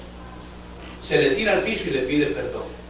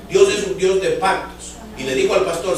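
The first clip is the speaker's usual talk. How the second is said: normal talking voice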